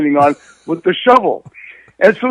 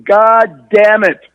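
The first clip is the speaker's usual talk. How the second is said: drawn-out, much louder curse; mock angry shout; 4 semitones higher, 8 dB louder, much slower than usual. Dad yelling at the car in the snow, slow loud mock shout. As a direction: stretch it out slowly like the fed-up dad.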